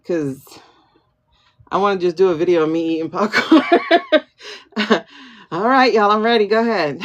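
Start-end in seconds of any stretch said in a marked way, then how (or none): 4.4-5.0 quick real laugh at herself; a light burst of laughter; amused little laugh; short laugh. Real, not acted. Laughing at own popcorn idea.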